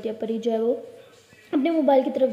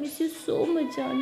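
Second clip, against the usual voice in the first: tender; much slower than usual. Acted. Ishani hugging Pari.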